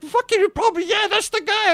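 very high, squeaky-pitched outburst; excited recognition. Retold or imitated. Storyteller tells his buddy it's the club guy, worked up. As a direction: blurt it high as it dawns on you.

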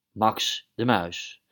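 plain reading voice

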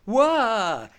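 loud high startled "Wah!"; horrified mock shriek. Horrified lady in the retold scene, loud high yelp. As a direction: yelp like you just saw a beheading. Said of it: "and goes".

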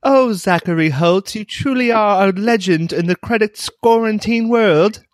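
high, swooning old cartoon damsel; cartoon damsel in distress. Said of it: "damsel in distress of an old cartoon movie".

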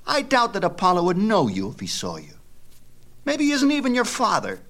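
put-on high, sneering boy's voice; a kid taunting his friend; taunting boy Epaphus. A narrator's reading.

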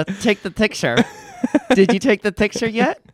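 talking through clenched teeth; through gritted teeth. Shown as a demonstration. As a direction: speak through a frozen grin. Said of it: "talk through your teeth".

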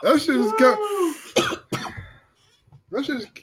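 struggling to talk, mouth on fire; broken words through the burn; mouth burning from spicy food. Real, not acted. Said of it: "you can't even talk".

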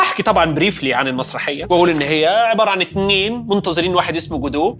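ordinary delivery

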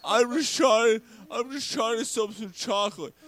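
put-on little fat kid. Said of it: "and the little fat kid".

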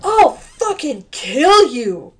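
loud, high, drawn-out furious shout; shouted threat. A narrator's reading. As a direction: yell the threat slowly.

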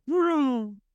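drawn-out mouth engine noise; mock engine rev for a speeding car; car engine vroom. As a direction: sound out the car roaring up. Imitating car arriving.

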